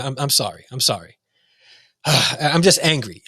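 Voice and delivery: usual speaking voice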